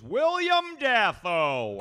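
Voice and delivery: huge, high, drawn-out holler; dad hollering. Retold or imitated. Dad spots film star on set, hollering with long vowels. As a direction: bellow the star's name slowly like an overexcited dad.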